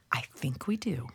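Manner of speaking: hushed breathy whisper. Acted. Mom whispering to Trent about the ginger, hushed. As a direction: whisper it under your breath. Answering while sneaking the ginger.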